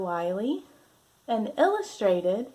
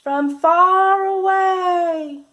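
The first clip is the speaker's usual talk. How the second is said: higher, louder and slow, as if calling across a distance; calling from far; 9 semitones higher, 12 dB louder, much slower than usual. Acted. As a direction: make far away sound far off. Acting out distant sound.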